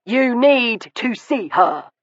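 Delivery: mocking echo. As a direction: parrot his words with a sneer. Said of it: "Marshwood mocked".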